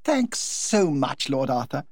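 Lady Windermere chatters happily to the Duchess; gushing society hostess. Acted. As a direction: toss it off like a delighted society hostess.

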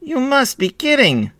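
high-pitched brotherly yell; high boy's exclamation; incredulous yell. A narrator's reading. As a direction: yell it in disbelief. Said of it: "yelled Itzik".

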